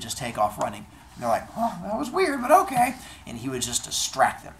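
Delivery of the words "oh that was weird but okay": high, loud bemused reaction; baffled bystanders. Acted. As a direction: pitch it up, puzzled but accepting. Bystanders baffled by his dodge.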